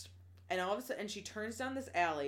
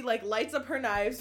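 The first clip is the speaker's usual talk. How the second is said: usual speaking voice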